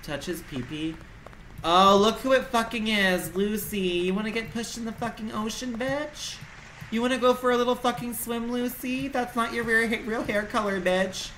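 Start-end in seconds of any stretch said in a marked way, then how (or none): 1.6-11.3 mocking taunt. Taunting game character Lucy.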